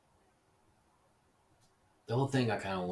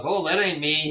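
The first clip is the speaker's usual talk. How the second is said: high, loud mock whine of denial; mock liar's denial; 10 semitones higher, 11 dB louder than usual. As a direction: play the caught liar, squealing innocence. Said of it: "Be like".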